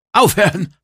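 high, loud cry to stop; shouting. A narrator's reading. Crushed narrator to the attacker, shouting. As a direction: shout it out under crushing pressure. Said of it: "schrie ich".

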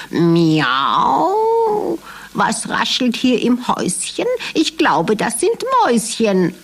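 a cat meowing its words; curious tomcat on the hunt. Acted.

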